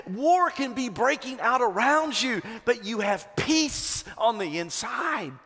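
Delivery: half-sung revival preacher climax; preaching at full force. Acted. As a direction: lift it to a shouting crescendo. Preaching peak on peace.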